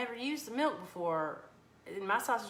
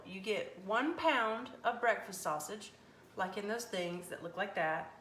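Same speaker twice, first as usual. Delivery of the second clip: ordinary talk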